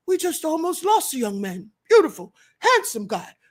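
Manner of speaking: lightly voiced Trump, rising at the end; Trump impression. YouTuber doing a Trump impression for viewers, lightly mocking. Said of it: "my own Trump impression".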